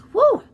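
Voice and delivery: high, loud whoop at the sharp taste; excited whoop. Real, not acted. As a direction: let out a sudden loud woo. Reacting to vinegar taste.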